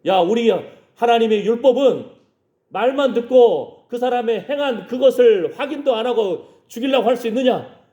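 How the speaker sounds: high, forceful acted argument; indignant defence. Nicodemus defending Jesus before the council, indignant challenge.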